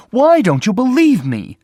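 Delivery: a sister furious at not being believed, crying out; angry cry. A narrator's reading. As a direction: fling it at him in fury. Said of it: "she cried angrily".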